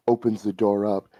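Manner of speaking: normal talking voice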